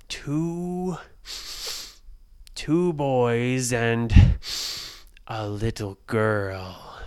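slow, loud, counting giant; booming giant. Acted. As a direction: stretch each word as the giant hunts. Giant searching and counting kids.

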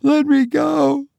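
high, mildly tearful plea; sobbing. A narrator's reading. Mother held back from son, mildly sobbing. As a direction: plead high with a touch of sobbing. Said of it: "she sobbed".

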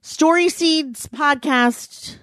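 slow, booming promo announcer over music; announcer read. Acted. Co-host plugging the camp link with big announcer voice. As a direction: spell out the web address like a radio ad.

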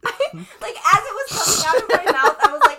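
fast, loud laughing speech; words tumbling out through laughter. Real, not acted. Still laughing while telling.

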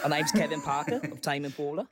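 put-on Aussie accent; Australian accent. Shown as a demonstration. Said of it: "Whenever I do an Aussie accent".

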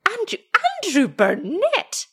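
high, rising exclamation; shocked old wife. A narrator's reading. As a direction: call the husband's name aghast. Shocked wife calls husband's name.

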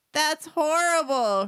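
loud, high, drawn-out disgust; utter repulsion. A narrator's reading. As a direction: stretch it out, revolted by the taste. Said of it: "Her face screwed up in utter repulsion".

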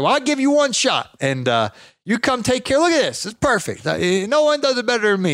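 put-on proud old man. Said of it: "he basically was like".

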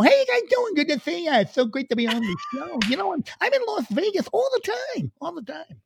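high-pitched late-night host impression; very high, put-on stand-up voice; Jay Leno. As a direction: squeal it like a chummy comedian. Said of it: "You know, Chris Jay Leno".

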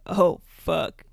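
loud, fed-up "oh fuck"; mock dismayed brother. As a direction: groan it out like a disgusted older brother. Brother reacts to coughing fit.

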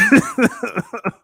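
loud, high laugh; real laugh, high and loud. Real, not acted. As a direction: burst out laughing. Laughs at his own reply.

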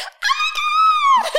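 very high, loud, slow mocking shriek; mock mum's squeal back at her; son mocking her squeal. Son in the car mimicking mum with a very high shriek. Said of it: "my son turns to me and goes".

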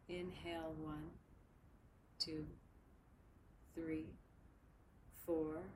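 slow, even counting with long pauses; slow breath counting. Shown as a demonstration. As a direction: count each breath slowly and softly. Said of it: "We'll begin to shape our breath together".

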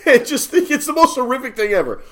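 high, loud words through real laughter; cracking up mid-sentence; laughing while talking. Real, not acted. Podcaster retelling his childhood terror, laughing hard.

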